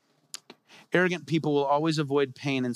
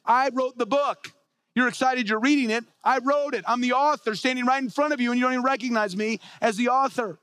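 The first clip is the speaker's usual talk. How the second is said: raised, high-pitched indignant rebuke; Jesus confronting the experts; 6 semitones higher than usual. Acted. Jesus rebuking the scripture experts who won't recognize him, indignant. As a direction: press each point home, pitched well up. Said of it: "where he would say things like this".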